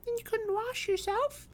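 put-on very high, solemn child voice; piping, serious childish question; grave little girl. A narrator's reading. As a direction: ask as an earnest small girl. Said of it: "interrupted his companion gravely".

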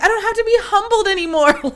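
high-pitched retold exclamation; gleeful outburst. Retelling her gleeful outburst on video.

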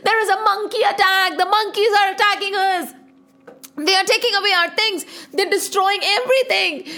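high, alarmed cries; frightened townsfolk. Acted. Townspeople crying out about the monkeys, high and frightened. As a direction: cry out as monkeys wreck things. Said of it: "Everybody was shouting".

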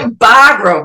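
broad Boston vowels; Boston accent. Shown as a demonstration. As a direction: drop the r like a Bostonian. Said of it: "I said it like a Bostonian".

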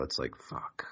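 weary whispered sigh. Acted. Weary sex joke.

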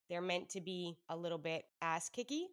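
ordinary delivery

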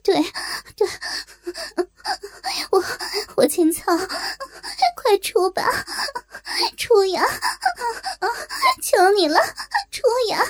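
stammering, climbing plea. Acted. 高声哀求. (结巴着、越来越高地哀求)

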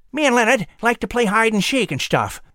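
whistling squirrel voice. Acted.

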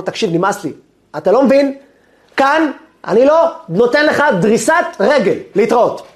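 exasperated, rising to high-pitched anger; really annoyed. Retold or imitated. Rabbi banning the caller from the prison, really annoyed. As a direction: lay down the law, then cut him off. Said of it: "ההוא ממש התעצבן עליו".

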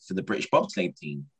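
lightly voiced coach, pitch rising high on the question; a hint of an eager coach; inviting question. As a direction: ask it like a keen recruiter. Coach invites him to try out.

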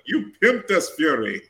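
put-on light English accent on "You pimped us, Fury"; a quick hint of English accent, quoting Kravitz; English accent imitation. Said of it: "the English accent killed me every time Kravitz said".

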